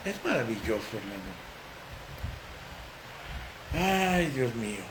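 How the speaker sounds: quiet marvelling aside to the congregation; hushed awe. Real, not acted. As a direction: murmur it in awe. Soft awed aside.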